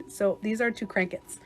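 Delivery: usual speaking voice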